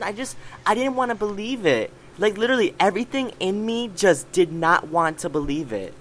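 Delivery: normal talking voice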